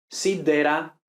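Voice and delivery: "Sidera" with a firm plosive D; tongue behind the teeth for the D of "Sidera"; almost doubled D in "Sidera"; hard stopped D. Shown as a demonstration. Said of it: "que no se vea la lengua entre los dientes".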